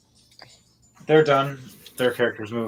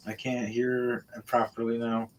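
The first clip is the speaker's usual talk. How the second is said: usual speaking voice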